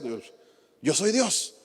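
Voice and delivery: a lightly voiced God, softly put. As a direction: answer calmly as God himself. Imagined dialogue, God answering.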